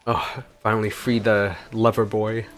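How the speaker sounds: ordinary delivery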